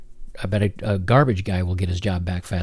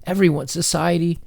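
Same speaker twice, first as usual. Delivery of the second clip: high-pitched, put-on poor-me lament; mock persecuted victim; 7 semitones higher than usual. Commentator imitating those who blame everyone, mock-persecuted. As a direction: mimic a self-pitying victim, pitched well up. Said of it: "again, it's the".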